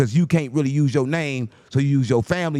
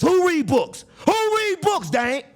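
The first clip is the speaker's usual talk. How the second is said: much higher, scoffing question; mocking disbelief; much slower than usual. Real, not acted. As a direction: scoff at the idea nobody reads. Ridiculing the books claim.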